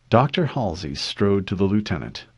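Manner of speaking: ordinary delivery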